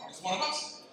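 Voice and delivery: ordinary talk, nothing marked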